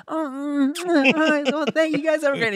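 high awkward stalling voice; awkward nervous guy. Retold or imitated. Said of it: "He's like".

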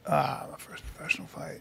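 very quiet, near-voiceless mock hesitation; imitated stalling. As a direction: murmur it like a caught-out faker stalling. Acting out the faker stalling.

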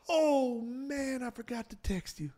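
high, then fading soft voice; lightly voiced soft-spoken older man; Garrett Morris impression. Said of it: "he has a, like, a whispery voice".

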